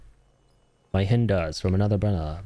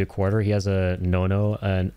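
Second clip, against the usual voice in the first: normal talking voice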